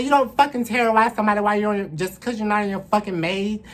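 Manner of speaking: loud, fast, high scolding; furious telling-off. Retold or imitated. Woman yelling at the off-his-meds man, furious. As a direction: yell at him with contempt. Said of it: "I'm like".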